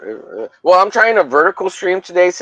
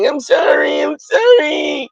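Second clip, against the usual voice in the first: high, drawn-out mock sobbing sorry; hammed-up apology; 10 semitones higher than usual. Streamer begging Peter's forgiveness, in a high mock cry. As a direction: overplay the tearful apology. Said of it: "And I'm like".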